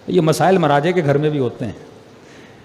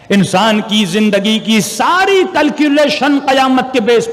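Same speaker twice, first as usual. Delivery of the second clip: preaching; 3 semitones higher than usual. Acted. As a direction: drive the lesson home from the pulpit. Preaching to the congregation.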